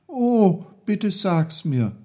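put-on high, eager boyish plea; pleading. A narrator's reading. Peter begs his uncle for the secret, pleading. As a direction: beg to be told, starting high and falling. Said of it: "bat Peter".

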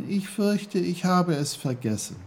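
put-on high boy's voice; high-pitched, apologetic admission; Peter, sheepish. A narrator's reading. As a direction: own up in a young, lifted voice. Peter admits he forgot.

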